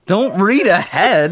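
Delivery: high, slow, through giggles; laughing. Real, not acted. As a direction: tease while laughing. Teasing, both laughing.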